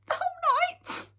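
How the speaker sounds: very high, loud squeak; squeaky. Acted. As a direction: squeak it out like a scared little creature. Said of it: "squeaked Sniff".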